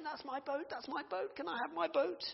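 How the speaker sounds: put-on high, excited little boy; bursting in, begging for his boat; eager kid pleading. Little boy begging the shop owner, high and excited.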